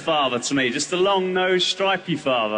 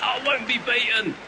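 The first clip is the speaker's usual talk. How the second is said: stubborn vow; 7 semitones higher than usual. Acted.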